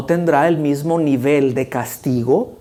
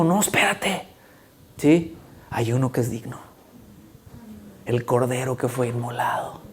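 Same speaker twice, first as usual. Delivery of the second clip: soft, lower, reverent; solemn revelation; 3 semitones lower, 7 dB quieter than usual. Acted. Angel telling John of the Lamb, soft and lower. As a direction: reveal it gently.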